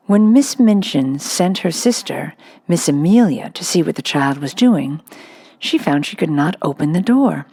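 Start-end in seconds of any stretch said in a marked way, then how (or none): none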